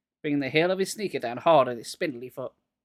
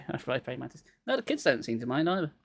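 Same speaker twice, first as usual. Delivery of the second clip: usual speaking voice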